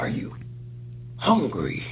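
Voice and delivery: hushed, halting villain impression; soft, strained-pause mock kingpin voice. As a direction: whisper it haltingly like a crime boss. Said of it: "the way Kingpin talks".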